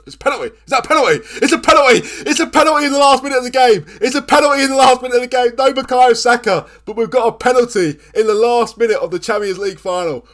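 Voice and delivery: high, loud, disbelieving cries of joy; ecstatic shouting. Real, not acted. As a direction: yell it like the winning goal just dropped. Last-minute penalty in a cup final.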